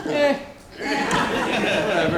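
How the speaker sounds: high, drawn-out laughing shrug of a whatever; playful fake nonchalance, stretched slowly; mock indifference. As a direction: brush it off like it's nothing, laughing. Joking about his character being killed.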